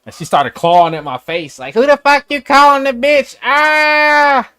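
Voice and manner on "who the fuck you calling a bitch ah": high, loud screeching woman voice; screaming crazy woman. Retold or imitated. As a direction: shriek it like a woman clawing at your face. Woman clawing, voiced in story.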